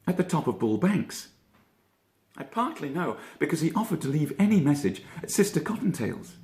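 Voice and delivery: high, quick puzzled rabbit; Peter Rabbit voice. A narrator's reading. Peter asking his cousin, puzzled high rabbit voice. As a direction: lift the voice into a curious young rabbit.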